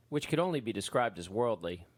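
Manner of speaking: plain reading voice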